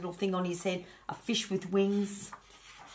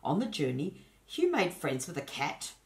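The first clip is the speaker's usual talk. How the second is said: ordinary delivery, nothing marked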